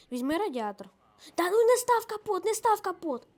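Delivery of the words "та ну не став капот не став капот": loud, high-pitched shout to stop; urgent protest. Real, not acted. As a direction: cry out in real alarm at a teammate's mistake.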